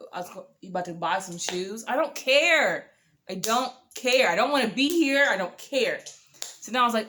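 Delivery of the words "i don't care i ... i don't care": fed up. Retold or imitated. Fed up on the date.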